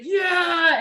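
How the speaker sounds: put-on high shouted kid's yes; eager child yell. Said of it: "they're usually like".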